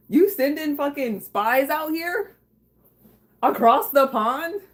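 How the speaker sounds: high, slow, drawn-out disbelief; mock outrage. Streamer accusing a viewer of sending spies, mock outrage. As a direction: playfully accuse them, stretching each word upward. Teasing viewer about a secret spy.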